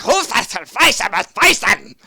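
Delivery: high, loud, drawn-out creature yell; mock reptile shout. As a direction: shout it like a shrieking lizard man. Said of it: "He's going like".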